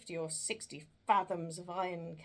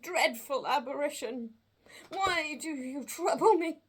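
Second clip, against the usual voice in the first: terrified pleading; 9 semitones higher, 9 dB louder than usual. Acted. Scrooge begs the phantom.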